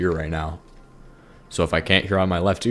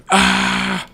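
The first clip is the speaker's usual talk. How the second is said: high mock shriek; high playful scream; imitated scream; 13 semitones higher, 4 dB louder than usual. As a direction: shriek like a nightmare intruder. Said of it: "screaming and going".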